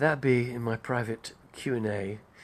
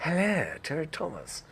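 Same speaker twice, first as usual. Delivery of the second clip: swooping, caddish English comic actor; Terry Thomas; 4 semitones higher than usual. Acted. Imitating the gap-toothed actor's greeting, swooping English voice. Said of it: "a little gap there with a".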